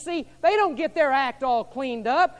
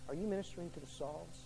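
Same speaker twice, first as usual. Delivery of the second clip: soft intense question; 5 semitones lower, 14 dB quieter than usual. Real, not acted. Quiet repeated question.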